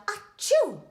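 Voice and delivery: big theatrical achoo sneeze; story sneeze sound; loud sneeze. A narrator's reading. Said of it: "heard a loud".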